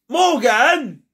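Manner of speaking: plain reading voice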